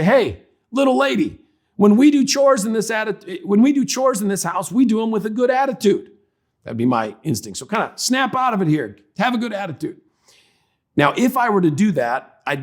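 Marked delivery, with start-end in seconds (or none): 0.0-6.1 high-pitched mock lecture to a child; scolding parent. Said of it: "My instinct in that situation would be to say". 8.0-10.0 higher-pitched mock telling-off; scolding parent. Acted scolding parent continues.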